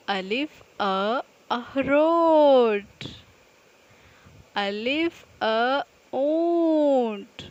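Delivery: slow, drawn-out classroom chant; repeat-after-me drill. Acted. Teacher to students, leading a slow recitation drill. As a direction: model each word slowly for children to echo.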